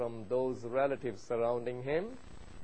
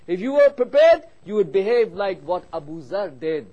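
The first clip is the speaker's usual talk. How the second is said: raised, higher preacher's voice, key words punched; forceful preaching; 4 semitones higher, 8 dB louder than usual. Real, not acted. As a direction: drive the lesson home loudly to the congregation.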